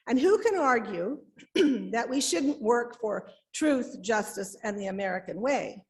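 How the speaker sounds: ordinary talk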